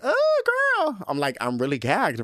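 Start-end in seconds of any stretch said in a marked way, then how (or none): 0.0-1.0 put-on a sudden very high, louder "Oh, girl". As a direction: shriek it like a startled girl.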